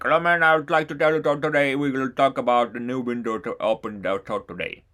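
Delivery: lightly done mock Indian English accent; a hint of an Indian accent, friendly greeting. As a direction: parrot an Indian speaker's English, half in fun. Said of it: "to parrot in them".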